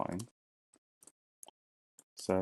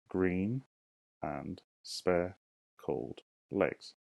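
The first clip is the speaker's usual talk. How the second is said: dragged out word by word, syllable by syllable; very slow syllable count; much slower than usual. Shown as a demonstration. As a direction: stretch every syllable for counting. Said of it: "Let's count them".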